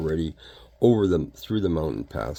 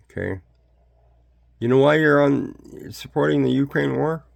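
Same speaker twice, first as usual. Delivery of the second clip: normal talking voice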